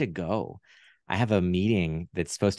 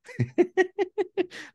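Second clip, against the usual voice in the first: high laugh. Real, not acted. Puppet voice breaks into laugh.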